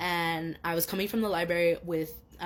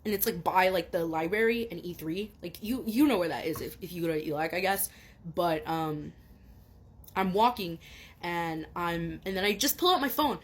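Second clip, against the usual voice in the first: ordinary talk, nothing marked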